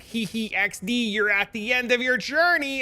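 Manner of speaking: high, slow, teasing singsong taunt; mocking game voice. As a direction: taunt the player in a high giggly voice. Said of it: "and it's like".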